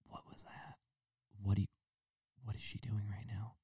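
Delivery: whispered disbelief, aside to self; incredulous whisper. Retold or imitated. Onlooker whispering in disbelief at the cooing couple. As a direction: hush it like you can't believe what you hear.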